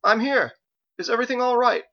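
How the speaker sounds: distant-sounding high holler; calling from far off. A narrator's reading. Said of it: "His voice was faint and far away".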